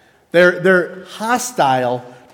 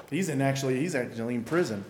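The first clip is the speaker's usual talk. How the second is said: much softer, confiding report; hushed news; 5 semitones lower, 10 dB quieter, much faster than usual. Retold or imitated. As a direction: lower the voice as if sharing gossip. Friend shares bad news quietly.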